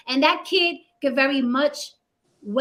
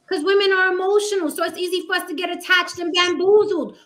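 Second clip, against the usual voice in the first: higher and louder, fired-up argument; heated rant; 7 semitones higher, 4 dB louder than usual. Real, not acted. Host lecturing the men on the panel, heated and loud. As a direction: keep the heat up.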